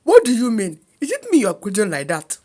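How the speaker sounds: mildly angry outburst, loud at first; affronted uncle, a touch of anger. A narrator's reading. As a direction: snap the opening question indignantly. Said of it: "He got very angry and said".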